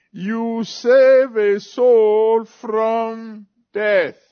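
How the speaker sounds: very slow, high and loud; preaching cry. Real, not acted. Preacher quoting James to the church, slow and loud. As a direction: draw each word out like a call.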